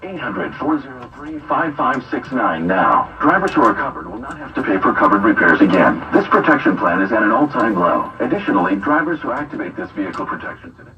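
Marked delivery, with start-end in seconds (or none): none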